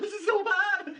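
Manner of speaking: anguished cringe. Real, not acted. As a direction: wail it out in pain. Cringing at terrible jokes.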